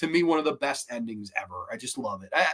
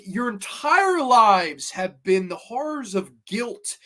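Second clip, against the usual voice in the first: ordinary talk, nothing marked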